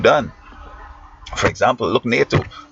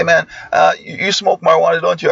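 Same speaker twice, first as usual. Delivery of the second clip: lightly voiced, higher, knowing student asking; teasing student; 8 semitones higher than usual. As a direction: tease him like a sure-of-himself teen. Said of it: "They say".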